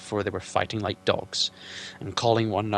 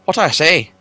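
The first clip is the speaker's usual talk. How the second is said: higher, louder eager hobbit; Bilbo blurting; 7 semitones higher, 14 dB louder than usual. Acted. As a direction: blurt it without thinking.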